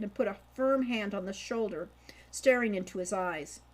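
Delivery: plain reading voice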